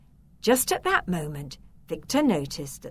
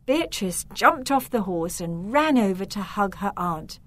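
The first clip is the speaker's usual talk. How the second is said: plain reading voice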